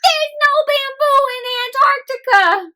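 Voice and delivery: high wailing panda voice; high dramatic panda wail; despairing panda. Acted.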